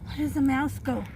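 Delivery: ordinary delivery, nothing marked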